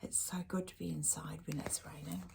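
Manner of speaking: soft, fast whisper; whispered aside. Acted. Reader murmurs to the child about the rain, whispering. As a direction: share a cosy secret.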